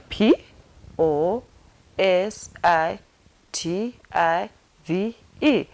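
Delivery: slow, separated letters; spelled out. Shown as a demonstration. Teacher spelling positive for sign learners, spelled out. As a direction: say each letter by itself with a pause. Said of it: "Let me repeat it again".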